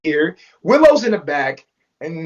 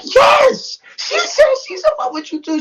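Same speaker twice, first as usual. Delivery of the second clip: a squealing, much higher Yes, she said; excited yes. Retold or imitated. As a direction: gush it out, thrilled to confirm. Serenity eagerly confirms in car.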